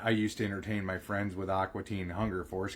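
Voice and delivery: ordinary talk, nothing marked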